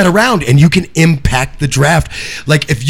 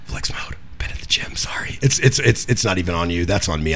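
whisper; 10 semitones lower, 10 dB quieter than usual. Real, not acted. Jokes while muted.

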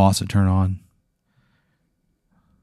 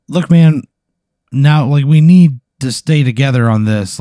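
louder and higher, pressing through a locked door; urgent plea through door; 5 semitones higher, 8 dB louder than usual. Acted. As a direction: urge him to open up, raising your voice. GM voices Marcus pleading at door.

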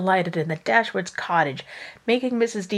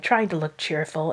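usual speaking voice

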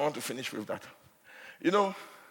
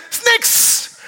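breathy, higher startled squeal; mock frightened cry; 5 dB louder than usual. Retelling a girl who couldn't say snake, mock scared squeal. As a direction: imitate a scared girl blurting it. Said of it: "She said".

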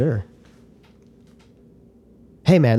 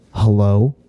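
ghost hello; 4 semitones lower than usual. Retold or imitated. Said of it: "we fucking straight up heard".

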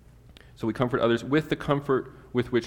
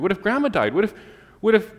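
put-on high, insistent kid questions, building louder; anxious child; 10 semitones higher, 5 dB louder, faster than usual. Child asking parent about deaths, anxiously insistent. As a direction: pile up what-ifs like a pestering child.

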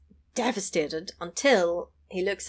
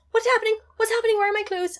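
panicked; 14 semitones higher, much faster than usual. Retold or imitated. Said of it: "she goes".

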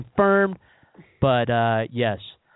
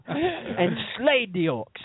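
Ice-T; 10 semitones higher, much slower than usual. Retold or imitated. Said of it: "he's like".

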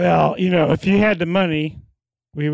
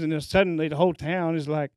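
usual speaking voice

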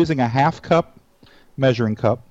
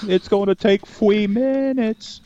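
cartoon voice; 8 semitones higher, slower than usual. Cartoon voice continues.

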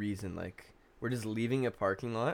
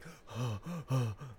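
imitated shocked heavy breathing; panicked gasps; 2 semitones higher, 4 dB quieter than usual. Driver reliving sitting at the wheel, panting in shock. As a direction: pant as if just escaped a crash. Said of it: "was just sitting at the wheel, like".

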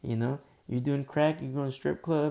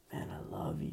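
tender whisper; 9 semitones lower, 7 dB quieter than usual. Retold or imitated. Thanking the brother who corrected him.

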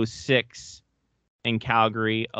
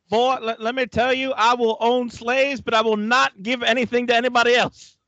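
put-on southern accent; 11 semitones higher, 4 dB louder than usual. Host keeps the southern gentleman voice, high and loud. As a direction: play the blustering southern gentleman.

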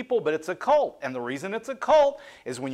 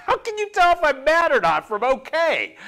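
exasperated disbelief; 9 semitones higher, 4 dB louder than usual. Real, not acted. Baffled reaction to "are you mad.

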